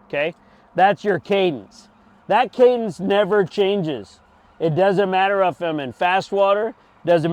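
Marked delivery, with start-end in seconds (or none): none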